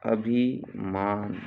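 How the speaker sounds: "अभिमान" slow, pause after अभि; split slowly for dictation. Shown as a demonstration. Teacher dictating a spelling, अभिमान slowly in two parts.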